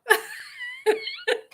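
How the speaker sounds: a high, squealing burst of laughter. Real, not acted. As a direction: let the laugh shoot up and crack.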